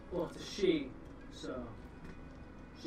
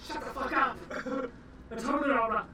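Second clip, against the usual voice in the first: higher, loud put-on snap; mock angry snap; 7 semitones higher, 3 dB louder than usual. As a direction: snap at his partner. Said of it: "He's like".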